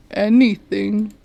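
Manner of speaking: a lightly voiced demon granting a wish, slow; low inhuman rasp. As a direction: stretch it out like a monster, no real accent. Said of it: "he rasped in a low, inhuman voice with a noticeable hint of German".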